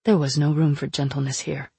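ordinary delivery

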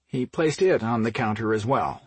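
usual speaking voice

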